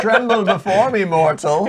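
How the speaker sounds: booming villain shout, high and loud; booming voice from sky. Acted. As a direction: boom it down like a god. Villain boasting over the table.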